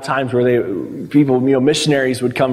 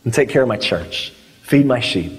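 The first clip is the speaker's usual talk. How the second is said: low imitated voice of instruction; Jesus; 5 semitones lower than usual. Preacher voices Jesus answering Peter, low-pitched charge. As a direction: drop the pitch for a restrained command. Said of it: "Jesus saying".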